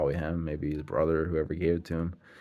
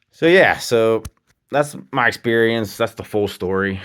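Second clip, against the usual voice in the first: loud wrap-up; 6 semitones higher, 13 dB louder than usual. Real, not acted. Wrapping up story emphatically.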